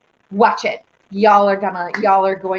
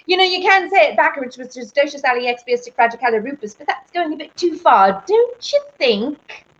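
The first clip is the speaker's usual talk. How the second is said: Mary Poppins; 4 semitones higher than usual. Acted. Host showing off a film line to viewers, in character. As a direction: rattle off the backwards word, then stretch the tag question. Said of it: "I watched Mary Poppins".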